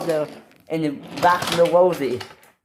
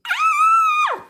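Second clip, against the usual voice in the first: very high-pitched squealed "ah"; shrill little story scream, way up high. Acted. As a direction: let out a tiny, sky-high shriek. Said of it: "she screamed".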